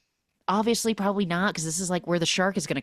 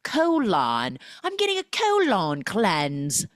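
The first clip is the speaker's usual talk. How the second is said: mock British accent, higher and drawn out; 3 semitones higher, 3 dB louder, slower than usual. Imitating the British bride's colon cleanse excuse, British accent. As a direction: exaggerate a posh British "Colon". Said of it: "she's British and from Outlander".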